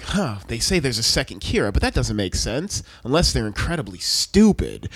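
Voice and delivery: put-on low, puzzled older authority figure; mock father musing in a low voice; Light's dad. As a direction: ponder it low, as an imitated dad. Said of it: "Light's dad is like".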